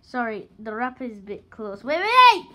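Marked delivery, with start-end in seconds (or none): 1.8-2.5 loud excited yell; shouting. Real, not acted. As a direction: yell it out in alarm. Kids shouting over candy.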